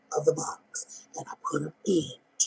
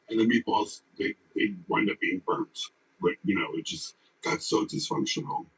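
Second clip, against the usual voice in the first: much lower, far-off imitation of the deep-voiced woman; 6 semitones lower, 4 dB quieter than usual. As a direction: drop low into her raspy storytelling. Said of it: "talks like this".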